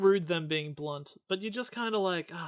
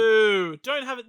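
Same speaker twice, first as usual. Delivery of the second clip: loud jokey boo; mock boo; 3 semitones higher, 9 dB louder than usual. Real, not acted. Booing the idea of having dreams, loud and jokey.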